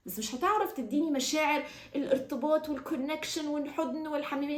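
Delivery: ordinary talk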